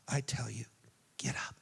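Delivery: put-on gentle whisper to a child; tender whisper. Jesus to the little girl, whispering.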